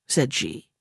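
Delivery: usual speaking voice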